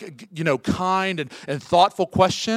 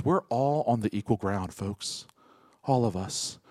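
hushed preaching; 5 semitones lower than usual. Acted. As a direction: lean in and speak the truth gently. Quiet reflective preaching.

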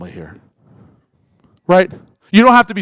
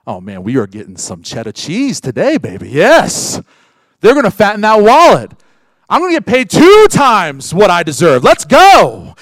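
loud, gloating payday hype; excited workers; 7 dB louder than usual. Acted. Preacher voicing workers hyped for payday, loud and gloating. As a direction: crow about the big paycheck coming.

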